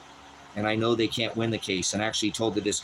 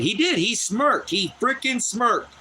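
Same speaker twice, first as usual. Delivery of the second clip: much higher, indignant, dragging out the last line; outraged; 10 semitones higher, slower than usual. Real, not acted. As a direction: spit out the insult of that smirk. Outraged at the attorney's smirk.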